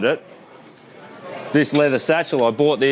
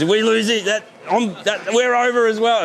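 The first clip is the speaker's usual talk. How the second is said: higher-pitched imitation of an alarmed wife; retold worried wife; 9 semitones higher than usual. Wife fears ruin after husband quits, imitated alarm. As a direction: mimic her panic lightly, pitched well up.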